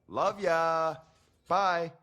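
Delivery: sugar-coat it, clearly insincere; mock-affectionate singsong send-off; fake sweet farewell. Fake sweet goodbye to Maria.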